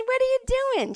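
very high-pitched mock challenge; indignant mom. Mom challenging a woman behind her house, indignant. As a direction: imitate an outraged mother's squawked question. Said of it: "my mom's like me".

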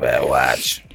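smoky street hustler voice. Retold or imitated. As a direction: growl it like a shady watch seller. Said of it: "smoked a million cigarettes in a thick accent".

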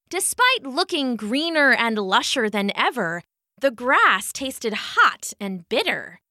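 usual speaking voice

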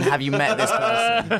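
high, slow mock question; drawn-out teasing ask; mocking quote. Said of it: "I always like to say that".

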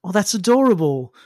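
mock-sweet condescension; condescending chuckle. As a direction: talk down to them like a cute child. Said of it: "He kinda chuckles and he goes".

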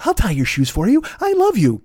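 mock girlfriend, leaping high on the declaration; devoted girlfriend. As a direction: plead love in a put-on girl's voice. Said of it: "the girl constantly patting behind him".

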